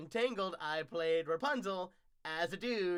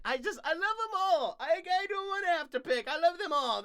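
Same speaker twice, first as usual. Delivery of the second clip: excited; 14 semitones higher, 11 dB louder than usual. Real, not acted. Can't pick between parks.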